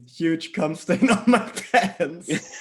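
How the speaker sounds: cracking up, words drawn out slowly; laughing. Real, not acted. Reading an embarrassing high school story to a friend, laughing. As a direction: struggle to read it through laughter.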